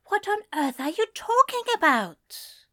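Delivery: high, flustered prim voice; bewildered angel. Acted. Angel demanding to know what the demon means. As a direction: ask in fussy disbelief.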